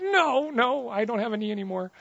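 high, strained comic wail; mock panicked reply. Preacher answers girl about lost knee, high comic wail. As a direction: squeal it like a man in pain. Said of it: "And I was like".

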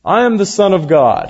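preacher mocking a self-important Jesus, proclaiming; mock proclamation. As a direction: ham up a grand announcement. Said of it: "you know".

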